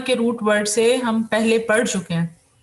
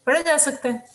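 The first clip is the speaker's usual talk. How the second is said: ordinary talk, nothing marked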